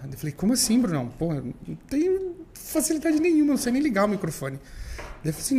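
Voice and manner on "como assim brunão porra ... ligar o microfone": higher-pitched, incredulous objection; imitating your own alarmed refusal; retold startled protest. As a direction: protest in a mock panic, pitch climbing. Retells his startled protest to friend.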